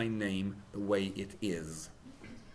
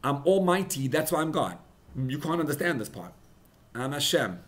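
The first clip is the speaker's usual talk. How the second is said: higher and much louder, a bold declaration; God proclaiming; 5 semitones higher, 8 dB louder than usual. Acted. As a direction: proclaim it as the Almighty. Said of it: "And he says".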